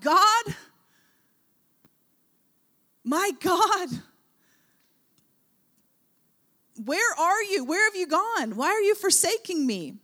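high, loud anguished cry. Retold or imitated. Preacher voicing Jesus crying out from the cross. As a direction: plead with an absent God in agony. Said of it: "he begins to cry out".

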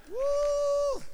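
long high whoop of victory; triumphant whoop. Real, not acted. Preacher celebrating his victory over the gang, high whoop. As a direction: let out a loud, soaring woo.

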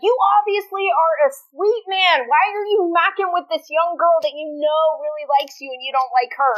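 high, mock-exasperated reproach; exasperated scolding. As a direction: lovingly tell off a frustrating character. Acts out scolding a show character.

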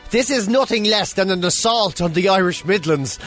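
normal talking voice

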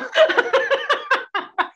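high bursting laugh; burst of laughter. Real, not acted.

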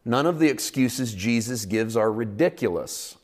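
normal talking voice

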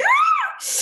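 very high shrieking laugh. Real, not acted. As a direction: let out a squealing laugh. Laugh after shriek joke.

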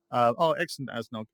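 normal talking voice

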